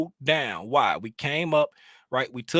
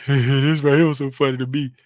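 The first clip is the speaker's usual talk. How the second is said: laughing through the words, louder; cracking up while saying it; real giggle breaking in; 3 semitones lower, 7 dB louder than usual. Real, not acted. Laughing at his own clip.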